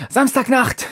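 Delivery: high shout into the dark. Acted. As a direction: cry it out, pitched high. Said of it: "schreit Pablo".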